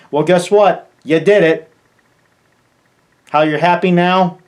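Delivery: loud, high, taunting challenge at the players; angry outburst. Real, not acted. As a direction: throw it at them like a dare. Angry rant at the game's community.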